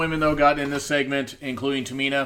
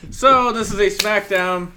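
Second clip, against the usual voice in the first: announcer voice; 7 semitones higher, 6 dB louder than usual. Acted. Announcer-style show intro.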